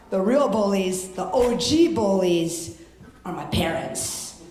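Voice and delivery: ordinary delivery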